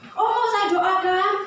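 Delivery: put-on slow, rising child's offer, laughing; a kid proudly offering to pray; eager child.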